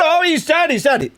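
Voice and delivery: high-pitched imitation of excited rumormongers; mock gossiping excitement. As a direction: squeal the rumor, mockingly thrilled. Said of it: "Gino, and Denny all saying".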